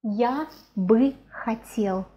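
slow, drawn-out "я бы хотел"; slow demonstration. Shown as a demonstration. Teacher modelling a Russian phrase for learners, slowly.

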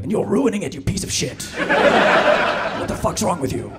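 angry Attenborough. Retold or imitated. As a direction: suddenly turn on the viewer with a hammed-up telling-off. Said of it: "And then he'll be like".

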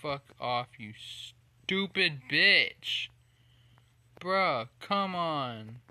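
loud, slow furious swearing; swearing tirade. Real, not acted. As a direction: spit out each insult. Gamer swearing at the game.

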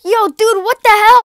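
loud, high indignant yelp; shocked outrage. Acted. Host shocked to find his own channel. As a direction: blurt it in disbelief.

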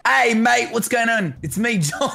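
overdone Aussie greeting; attempted Australian accent. Shown as a demonstration. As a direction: badly fake an Australian accent, breaking into a laugh. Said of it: "how good my Australian accent is".

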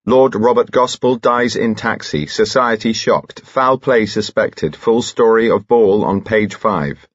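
newspaper headlines. A narrator's reading. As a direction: read out the headlines like breaking news.

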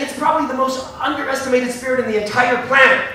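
excited claim. Retold or imitated. Retold heated claim to journalists.

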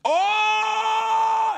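long, high whooping shout; loud, held high cry. Real, not acted. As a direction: holler a drawn-out reaction to a pun. Groaning at the bizarre pun.

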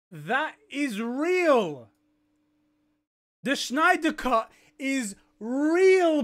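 slow, each word landed with weight; slow emphatic. Real, not acted. Streamer staring at the official post, slow and emphatic. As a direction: savour every word, slowly.

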